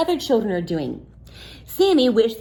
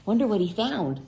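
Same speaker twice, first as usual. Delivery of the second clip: usual speaking voice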